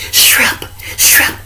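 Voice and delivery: hushed urgent whisper; whispered call. Retold or imitated. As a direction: whisper the name to get attention. Girl whispering his name.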